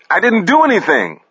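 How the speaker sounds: high-pitched panicked cry; screaming for his life. A narrator's reading. Paris at gunpoint denying it, screaming for his life. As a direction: plead innocence in terror, voice way up. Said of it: "Paris screaming for his life".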